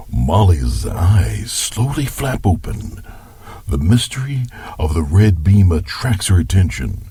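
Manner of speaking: story narration. Acted. Grandpa narrates the film-like tale.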